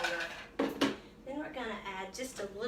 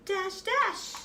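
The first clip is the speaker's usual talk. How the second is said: high and louder dash dash as sound effect; playful sound effect; 9 semitones higher, 4 dB louder than usual. Shown as a demonstration. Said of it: "The sound effects are good".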